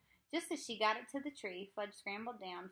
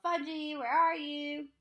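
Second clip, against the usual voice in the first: louder call, pitched higher; 7 semitones higher, 9 dB louder, slower than usual. A narrator's reading. Sheila calling for Fudge in the yard, loud. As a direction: call across the yard for him.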